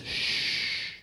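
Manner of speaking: a soft unvoiced shush; hushing shh. God hushing everyone so he can hear. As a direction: hush the room gently. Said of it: "But God says".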